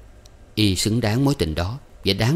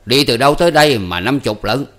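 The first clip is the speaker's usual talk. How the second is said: loud, higher, indignant question; fierce challenge; 4 semitones higher, 7 dB louder than usual. A narrator's reading. Uncle challenging a pedicab driver's fare, fierce. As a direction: confront the driver with raised voice. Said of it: "thấy bộ Hai Lọt dữ tợn".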